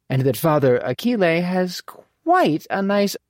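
high, animated lady's voice; teasing mother in a lilting high pitch; brisk, playful mother. Acted. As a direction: swoop up high to brush off all objections. Mother brushes off her son.

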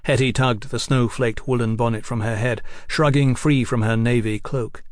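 usual speaking voice

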